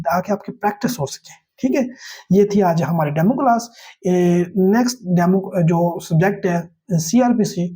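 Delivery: ordinary delivery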